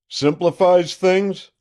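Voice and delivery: "Simplifies" thrown back high, loud and slow; a boastful sheriff mocking the word; scornful echo.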